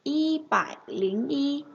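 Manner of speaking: y bải lính y drawn out slowly; slow model reading. Shown as a demonstration. Teacher modeling y bải lính y slowly for students. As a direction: spell it out slowly for learners. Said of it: "sẽ đọc là".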